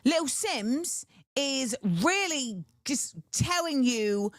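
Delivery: review it in a mock British accent. Discussing the British rapper's album, put-on British accent. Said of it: "talk about it in a British accent".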